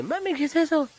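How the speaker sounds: squeaky high cartoon voice. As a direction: pitch way up for a cartoon kid. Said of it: "I did that one with, like, emotion".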